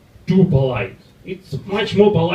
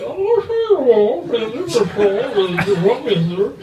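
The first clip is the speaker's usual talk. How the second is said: high, very slow, haughty British drawl; stiff posh Brit; 6 semitones higher, slower than usual. Retold or imitated. Mocking a stiff Brit with a half-shut mouth, slow and high. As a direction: stretch it out like a snooty Englishman. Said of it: "real Brit is a, a stretched neck, half-shut mouth".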